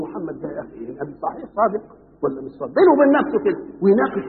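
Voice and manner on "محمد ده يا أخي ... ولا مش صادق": musing to himself. Retold or imitated. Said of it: "يقعد بينه وبين نفسه يقول".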